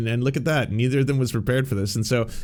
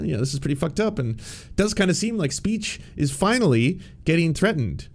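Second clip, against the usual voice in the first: normal talking voice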